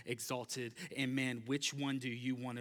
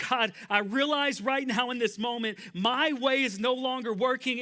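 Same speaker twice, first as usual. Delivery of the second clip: loud, raised pleading prayer of a broken man; desperate sinner's cry, louder and higher; crying out to God; 10 semitones higher, 9 dB louder than usual. Acted. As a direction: beg God out loud, voice lifted high. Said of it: "as he's crying out to God".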